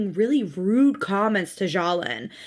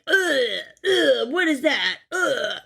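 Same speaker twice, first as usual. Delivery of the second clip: mock groans of disgust; disgusted husband; 3 semitones higher, slower than usual. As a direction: sneer at the food.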